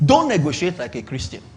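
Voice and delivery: ordinary delivery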